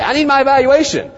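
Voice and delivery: high, loud demanding employee; savvy employee. Retold or imitated. Employee asking for a raise in disguise, high and loud. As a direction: insist on it like a staffer angling for a raise. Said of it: "So they say".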